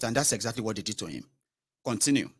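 ordinary talk

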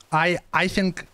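normal talking voice

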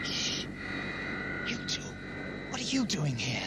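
soft, breathy whisper. Acted. The Doctor whispering to the two stowaways. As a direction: hush them so no one hears. Hiding, must not be heard.

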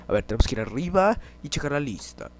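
usual speaking voice

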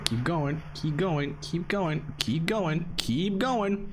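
mounting self-urging chant; building urgency. Real, not acted. Urging himself on, repeated.

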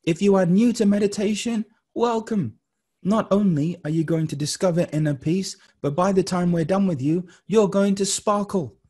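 high, emphatic, lilting oratory; motivational address. Acted. Guide welcoming newcomers to meditation, high motivational address. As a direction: lift each phrase like a rousing welcome.

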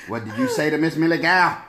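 ordinary delivery

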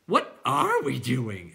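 slow, loud clown question; clown creature voice. A narrator's reading. Clown asking companions, slow and loud. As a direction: draw it out in a silly voice.

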